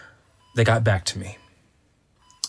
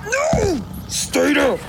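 playful shout; much slower than usual. Acted. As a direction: bark it out while holding them down. Holding friend down while wrestling.

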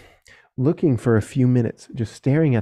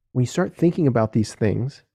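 usual speaking voice

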